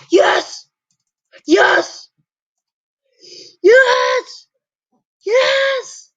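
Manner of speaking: rising triumphant cheer; triumphant yes. Real, not acted. Glitch finally works.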